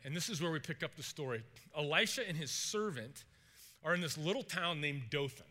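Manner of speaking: ordinary talk, nothing marked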